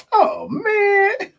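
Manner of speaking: high, loud shocked "oh, man"; mock shocked shout. The newcomer reacting to the reveal, mock shocked shout. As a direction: yell it like the joke just landed. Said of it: "That joke gonna say".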